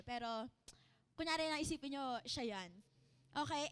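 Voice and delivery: ordinary talk, nothing marked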